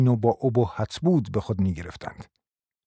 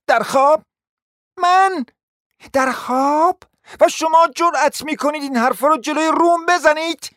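high, loud incredulous shout; outraged disbelief; 5 dB louder than usual. Acted. Hostess shrieking at the insulting guest.

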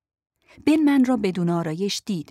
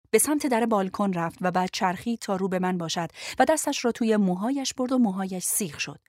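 usual speaking voice

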